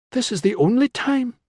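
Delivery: high-pitched, insistent urging; urgent pleading. A narrator's reading. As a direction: press your case, pitched well up. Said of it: "she urged".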